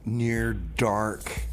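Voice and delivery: ordinary talk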